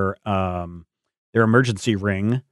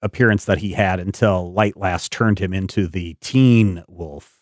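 normal talking voice

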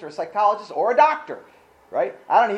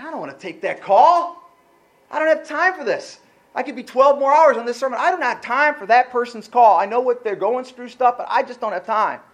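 irritated protest; 5 semitones higher, 6 dB louder than usual. Retold or imitated. Retold irritated inner protest.

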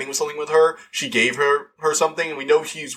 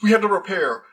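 higher, urgent panicked warning; frantic warning; 7 semitones higher than usual. Retold or imitated. Loki warning the TVA, higher panicked pleading. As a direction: rattle it off like a man nobody believes. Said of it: "He starts shouting at them".

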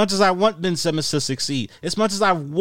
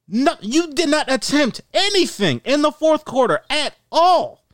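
exasperated rant; 10 semitones higher than usual. Real, not acted. Ranting at Ben Simmons.

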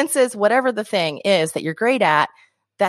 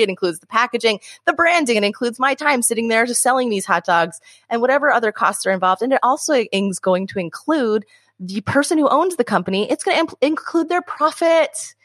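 ordinary delivery

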